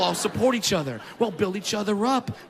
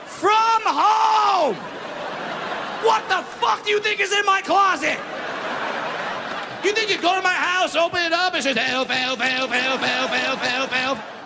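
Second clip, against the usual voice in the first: high, loud outraged yell; outraged shout; 8 semitones higher, 3 dB louder than usual. Real, not acted. Comic ranting at a Christmas casting notice, loud and outraged. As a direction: blow up at the casting call.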